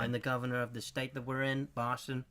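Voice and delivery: normal talking voice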